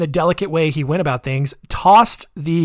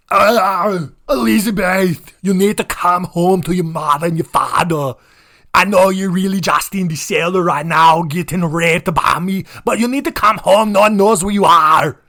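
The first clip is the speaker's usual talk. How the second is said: higher, louder Austrian accent with a fake sob; mock Schwarzenegger plea; 4 semitones higher, 4 dB louder than usual. Father pleading on TV for daughter, mock-tearful Austrian accent. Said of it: "bad Arnold Schwarzenegger impression for the rest of the show".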